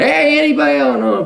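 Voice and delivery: high mock call into empty room. Lonely man calling out to nobody, high call.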